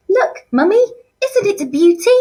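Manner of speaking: put-on high, far-off childish posh voice; Sheila impression. Teacher voicing Sheila for year eleven, high and girlish. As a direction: squeal it like a spoilt girl showing off her ring. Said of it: "my impersonation of how I think she speaks".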